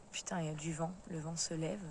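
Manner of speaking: quiet muttered aside; hushed aside. Real, not acted. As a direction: drop the voice low and soft. Aside, hushed wind remark.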